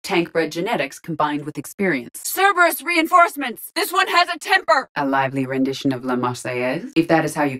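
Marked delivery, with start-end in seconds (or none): 2.2-4.9 high-pitched battle warning; urgent combat shout. Acted. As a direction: shout an alert to the squad.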